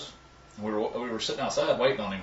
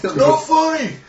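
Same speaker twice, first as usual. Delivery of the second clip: high, loud mock whine; mock protest; 10 dB louder, slower than usual. Friends mock the wrestlers' protest in high loud whines. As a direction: ham up an offended whine.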